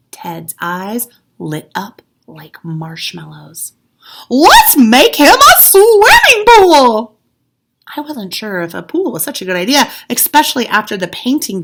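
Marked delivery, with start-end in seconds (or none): 4.3-7.2 high, loud, slow thrilled cry; squealing with delight, drawn out; excited Ted. A narrator's reading. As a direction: burst out with the big idea. Ted's excited idea.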